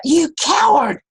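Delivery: high, loud taunting shout; boy yelling at his echo in anger; angry yell. Retold or imitated. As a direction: hurl the insult at the hills. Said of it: "and he yelled out".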